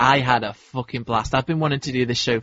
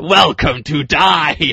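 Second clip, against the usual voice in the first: slow, loud villain proclamation; cartoon villain; 3 semitones higher, 6 dB louder, much slower than usual. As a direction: boom it out like a hammy cartoon villain. Quotes villain line from old cartoon.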